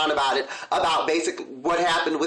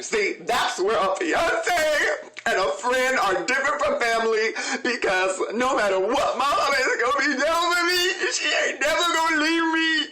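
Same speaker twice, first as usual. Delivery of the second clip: higher, wailing mock sob through laughter; mock crying; 6 semitones higher than usual. Host mocking Kandi crying about her mother, hammed-up sobbing. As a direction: ham up a teary whine about mama. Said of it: "she starts crying and she's like".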